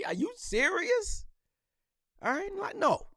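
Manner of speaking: incredulous. Real, not acted. Refusing to greet Dallas players.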